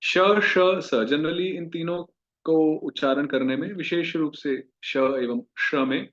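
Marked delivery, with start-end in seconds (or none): 0.0-0.9 "श, श" then "स", each hissed clearly and louder; three sibilants demo. Shown as a demonstration. Teacher to class stressing three sibilants, louder. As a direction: sound out "श, श, स" one by one. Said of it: "ये काफी महत्वपूर्ण है".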